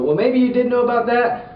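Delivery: normal talking voice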